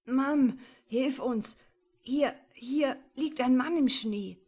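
put-on boy calling his mother for help, trembling. A narrator's reading. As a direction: cry for help into the phone. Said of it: "mit bebender Stimme".

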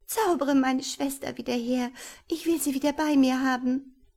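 high, loud desperate demand; desperate cry. Acted. Girl demands her sister back from wizard, desperate cry. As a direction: cry out in despair for your sister. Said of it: "rief Wehmut verzweifelt".

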